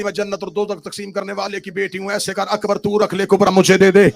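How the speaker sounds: loud, half-sung pleading chant; full-force majlis plea. Acted. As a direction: climb to a shouted lament. Sister's plea, building chant. (بہن کی فریاد، بلند نوحہ خوانی)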